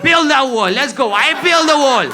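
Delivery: high, hammed-up battle cry; mock rallying shout. Cousins cheering on deportation, mock rallying shout.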